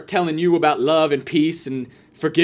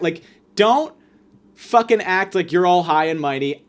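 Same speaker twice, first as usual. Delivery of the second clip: ordinary talk, nothing marked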